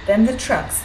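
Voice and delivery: usual speaking voice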